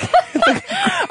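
high bursting laugh; big laugh. Real, not acted. Host laughing at airport crying, a high burst.

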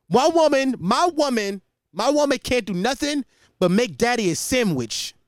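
loud, high haranguing rant; Andrew Tate impression. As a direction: shout it like a macho agitator. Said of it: "that's just my Andrew Tate impression".

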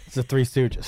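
usual speaking voice

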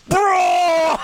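high, long-held mock bellow; Braun Strowman. Answering why he screams his own name, mock scream. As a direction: drag the name out in a joking scream. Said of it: "why are you just screaming your name".

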